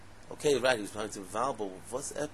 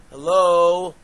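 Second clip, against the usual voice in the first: very loud, drawn-out "hello?"; big incredulous holler; mock incredulous; 5 semitones higher, 17 dB louder than usual. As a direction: call out to someone missing the obvious. Mock calling out for attention.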